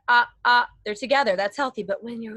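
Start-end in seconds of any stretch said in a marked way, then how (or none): 0.1-0.7 short loud sung ahs; loud voiced ah. Shown as a demonstration. Coach demonstrates voiced chords with loud ahs. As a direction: punch out two voiced ahs. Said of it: "but when you talk".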